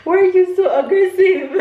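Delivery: high, loud squeal through laughter; laughing protest. Real, not acted. Mother to her rough little one, laughing protest.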